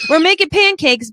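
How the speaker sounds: cheery botched Australian morning-show voice; attempted Australian accent. Host imitating Melbourne morning show despite failing, attempted Australian accent. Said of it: "I just can't".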